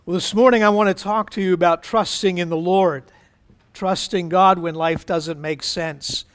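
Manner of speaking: ordinary delivery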